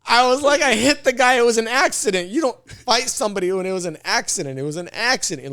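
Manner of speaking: high, loud objection, easing down by the end; exasperated protest. Retold or imitated. To his cousin who wanted to fight, exasperated retold protest. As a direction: plead sense into him, retelling it with force.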